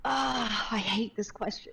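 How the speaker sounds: mildly pained, drawn-out complaint; exasperated groan. Real, not acted. Streamer reacting to a hard favorite-bands question, exasperated. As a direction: groan it out, dreading the answer.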